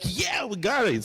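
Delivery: high-pitched, playful imitation of her yes; mock-enthusiastic quote, voice jumping up; imitating eager reply. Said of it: "She's like".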